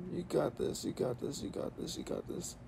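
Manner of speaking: whispering to myself, fading out; whispered pep talk. Real, not acted. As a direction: hush it to yourself, willing it through. Repeated self-encouragement, unvoiced.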